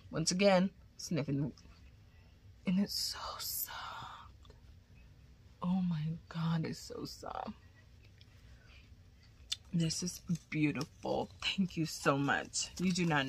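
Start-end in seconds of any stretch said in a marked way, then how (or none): 2.7-7.5 hushed, slow delight; soft whisper. Real, not acted. Touching the soft yarn, whispering in delight. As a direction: breathe it out, slowly.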